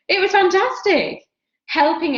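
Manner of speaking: bursting up high with delight; a sudden high, loud burst of real delight; excited. Real, not acted. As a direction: gush it, really thrilled. Recalling loving her runner job.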